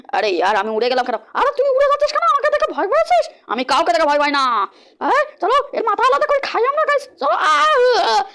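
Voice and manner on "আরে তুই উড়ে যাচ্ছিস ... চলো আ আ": much higher, gleeful cartoon demon cackling; mock the player and laugh; taunting devil voice. Devil gang mocks the flying player, high and gleeful.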